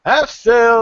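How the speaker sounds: high, loud, drawn-out sailor's call; shouted relay. Acted. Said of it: "he relays the message back".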